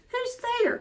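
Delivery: put-on high old granny voice calling out; grandmother calling. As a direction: call out like a frail grandmother.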